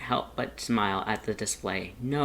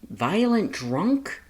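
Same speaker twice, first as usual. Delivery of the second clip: slow, much higher disbelieving echo; parroting in alarm; 9 semitones higher, 6 dB louder, much slower than usual. A narrator's reading. Dance echoes Grilby, bristling and alarmed. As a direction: repeat it back in protective alarm. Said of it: "Dance parroted".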